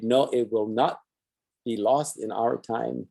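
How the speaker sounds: normal talking voice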